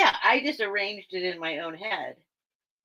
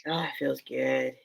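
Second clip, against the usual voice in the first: softer, lower, relieved; relieved sigh; 7 semitones lower, 6 dB quieter than usual. Real, not acted. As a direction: sink into the cool air. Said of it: "let me fan myself a minute".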